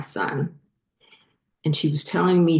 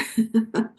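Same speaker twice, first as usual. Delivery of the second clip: short teary laugh; 6 semitones higher than usual. Real, not acted. Embarrassed laugh after tears.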